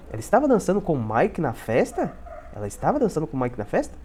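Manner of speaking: "festa" lifted into a clear question; question intonation. Shown as a demonstration. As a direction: ask it with a rise at "festa". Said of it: "tem que fazer essa entonação". (entonação de pergunta, subindo em "festa")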